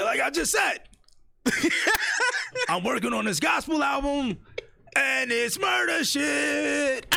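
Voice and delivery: loud shouted [name] voice, high, last words very slow; [name] impression. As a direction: shout it like [name] repeating himself, laughing in between. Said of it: "He said".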